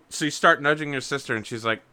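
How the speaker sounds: ordinary delivery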